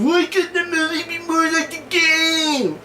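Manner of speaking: high, slow mocking whine; mock a griping gamer, drawn out; hammed-up whiny gamer complaint; whiny gamer gripe. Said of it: "gamers tend to gripe about".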